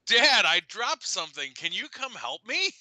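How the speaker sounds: put-on high sweet little-girl plea; innocent child voice. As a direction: sound falsely innocent. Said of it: "then she goes".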